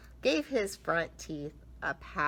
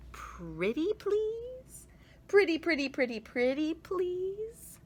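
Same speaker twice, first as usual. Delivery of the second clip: sweet pleading; 12 semitones higher than usual. Acted.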